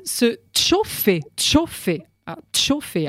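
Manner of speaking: "chauffer" said with a hard "tch" at the start; Vaudois-style "tch" opening on "chauffer"; special patois pronunciation. Shown as a demonstration. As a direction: put a t before the ch of "chauffer", slowly and distinctly. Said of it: "c'est aussi une prononciation spéciale".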